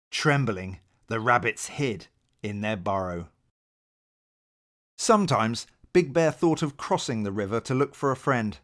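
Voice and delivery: plain reading voice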